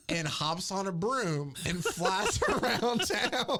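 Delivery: giggling through the words, slowed down; can barely get it out for laughing. Real, not acted. Laughing as he tells his friend about the broom. Laughing while telling the story.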